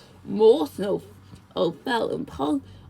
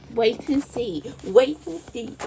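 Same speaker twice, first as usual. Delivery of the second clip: ordinary delivery, nothing marked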